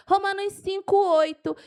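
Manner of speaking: loud, high, excited citation; fired-up preacher listing verses; carried away. Real, not acted. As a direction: punch out the reference with enthusiasm. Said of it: "eu me empolgo".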